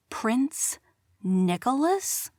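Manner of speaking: stunned, slow rising question; Kara stunned to see the prince in her studio. A narrator's reading. Kara stunned at the prince, a slow rise. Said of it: "Kara said in a stunned voice".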